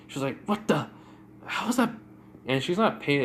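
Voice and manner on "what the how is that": high-pitched, baffled outburst; startled disbelief. Retold or imitated. Charmcaster sees her magic fail, startled disbelief. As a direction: blurt it out, caught off guard.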